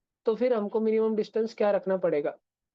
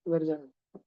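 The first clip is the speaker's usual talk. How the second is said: movie line quote; 4 semitones lower, 4 dB quieter, slower than usual. Retold or imitated. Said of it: "उसमें ऐसा scene था".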